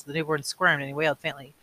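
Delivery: ordinary delivery, nothing marked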